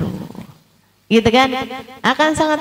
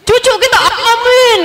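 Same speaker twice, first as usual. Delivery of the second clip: much higher, mock hopeless amen for the grandkids; imitating a parent who has given up on himself; resigned amen; 12 semitones higher, 3 dB louder than usual. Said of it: "Kayak aminnya tuh gini ya". (menirukan orang tua pasrah, suara jauh lebih tinggi)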